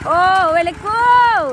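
high, loud, very slow drawn-out cheer; delighted cheer. Real, not acted. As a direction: stretch out the praise with delight. Cheering the turn. (ชมเสียงดังลากยาวอย่างดีใจ)

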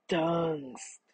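slow crocodile rasp. A narrator's reading. Crocodile thanking the man for water, rasping. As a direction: rasp it out like a big reptile. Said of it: "it rasped".

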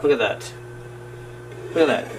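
usual speaking voice

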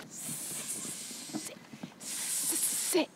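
unvoiced soft command; whispered sit. Real, not acted. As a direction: breathe the word so he won't startle. Coaxing startled dog quietly.

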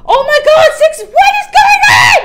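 very high, very loud disbelieving shriek; real ecstatic scream. Real, not acted. Screaming over milestone.

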